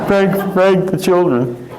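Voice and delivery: high-pitched imitation of a protesting grandma; pleading grandmother. Grandmother hushes cursing husband near children, high mock-alarmed protest. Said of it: "And she'd say".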